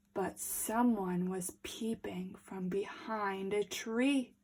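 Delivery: storyteller's secret whisper; suspense whisper. A narrator's reading. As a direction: whisper the lurking danger. Suspense as wolf appears.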